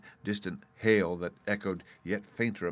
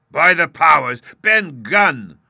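very loud, high roar; 9 semitones higher, 17 dB louder, slower than usual. A narrator's reading. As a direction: roar out the discovery. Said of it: "roared Silver".

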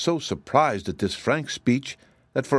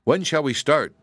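higher-pitched keen question; glad, eager; 7 semitones higher than usual. A narrator's reading. Scarecrow keen to embark on an adventure, eager. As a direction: ask as one itching to be off. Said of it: "always glad to embark upon a new adventure".

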